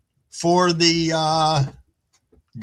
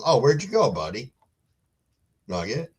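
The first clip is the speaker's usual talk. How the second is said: usual speaking voice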